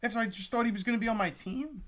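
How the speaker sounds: ordinary delivery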